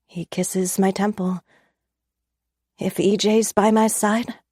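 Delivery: ordinary delivery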